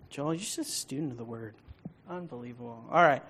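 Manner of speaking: ordinary talk, nothing marked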